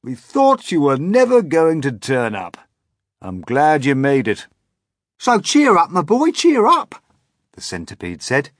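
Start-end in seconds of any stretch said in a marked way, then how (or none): 0.0-4.5 higher, louder grasshopper welcome; old grasshopper voice. Acted. Grasshopper welcoming James, higher and louder. 5.2-7.0 very high, loud, hearty centipede. Acted. Centipede cheering up James, very high and loud.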